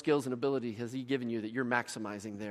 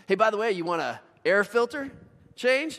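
perky upsell, pitched higher and louder; pushy mechanic's upsell, raised and louder; 8 semitones higher, 7 dB louder than usual. Retold or imitated. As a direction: sell the air filter brightly. Jiffy Lube worker upselling.

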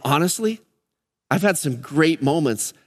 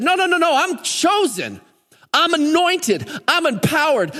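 high, ringing declaration of conviction; emphatic refusal; 13 semitones higher than usual. Acted. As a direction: protest it high and insistent, acting out David. Imagined David resisting temptation on rooftop.

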